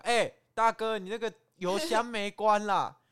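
loud retold call to a driver; calling out loudly. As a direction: shout it across the traffic. (大声喊)

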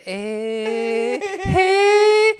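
very high, loud, stretched-out call; mock the friend's goofy greeting; 拖长怪叫. 朋友边唱边笑叫醉友. (高声拖长的怪腔招呼)

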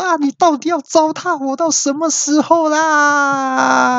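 very high, slow crying yell; hammed-up frantic crying scream; frantic wailing yell. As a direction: despair at the gadget. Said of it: "我就疯狂大叫说".

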